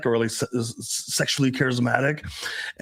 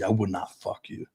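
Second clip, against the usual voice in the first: low, soft, unhurried refusal; quietly firm tease, pitched down; teasing refusal; 6 semitones lower, 7 dB quieter than usual. Shown as a demonstration. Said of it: "a little bit more playful, not like, a angry".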